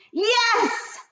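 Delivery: very high triumphant yes; thundering witch. Acted. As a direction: crow it in triumph. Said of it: "thundered the Grand High Witch".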